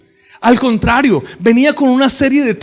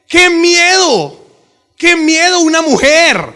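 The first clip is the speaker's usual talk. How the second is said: high, slow mock-frightened cry; mock fear; 6 semitones higher, 3 dB louder, slower than usual. Preacher voicing Joseph fleeing the woman, mock fear. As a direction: ham up a scared young man. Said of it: "decía José".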